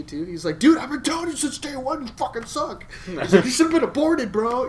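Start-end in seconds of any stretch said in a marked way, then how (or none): 0.6-2.9 high-pitched mock taunting; jeering roommate. Roommate needling him after losses, mocking taunt. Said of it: "he'll be a dick to you". 3.4-4.7 high, loud mock insult; jeering roommate. Roommate trash-talking the loser, jeering. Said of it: "he'll be a dick to you".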